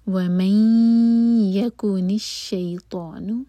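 وَمَنْ يَكُنِ with the ن merged into ي through the nose, held two seconds; nasal glide from وَمَنْ into يَكُنِ, slow; merged nasal noon. Shown as a demonstration. Teacher shows the merge in وَمَنْ يَكُنِ, slow. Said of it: "نون اور یا کو مرج ... ناک میں آواز".

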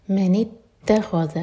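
slow, with the ta of اتخذ doubled and pressed. Shown as a demonstration. Teacher modelling the shadda to students, slow. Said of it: "تا پر شدہ".

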